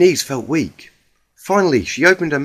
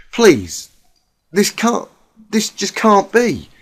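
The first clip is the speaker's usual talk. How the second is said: tearful pleading; 7 semitones higher, 4 dB louder, slower than usual. Acted. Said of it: "Applejack's tears were now hitting".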